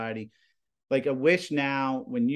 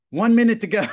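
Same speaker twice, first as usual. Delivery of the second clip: much higher and louder sudden call; crying student's countdown; 10 semitones higher, 10 dB louder, faster than usual. Retold or imitated. Crying student warns teacher of airtime, high loud blurt.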